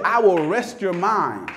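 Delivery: high, shouted sermon climax, words stretched out; full-force preaching. Acted. Preacher voicing Jesus's promise to the congregation, full-force shouted preaching.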